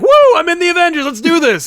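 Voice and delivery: high, loud, rushed mock enthusiasm; hammed-up excited cheer; excited Sam. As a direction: whoop it up like an overeager new recruit. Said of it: "Sam's just excited".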